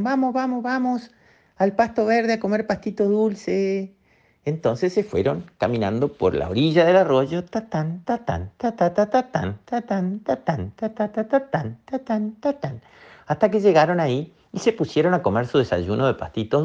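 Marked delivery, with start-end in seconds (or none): 0.0-3.9 high, eager little voices, much higher; excited babies begging to go eat, squeaky; baby otters. Acted. Baby otters urging each other to breakfast, high and eager. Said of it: "se despertaron las nutrias bebés y dijeron". 7.4-12.8 soft pattering ta tan steps, bouncing up and down; walking footsteps. Acted. Narrator mimics the otters trotting along the stream, soft rhythmic patter.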